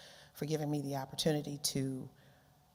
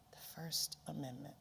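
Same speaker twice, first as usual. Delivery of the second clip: whispered, breathy echo of The First Amendment; 12 dB quieter than usual. Shown as a demonstration. Keynote speaker whispering it back to the crowd, hushed.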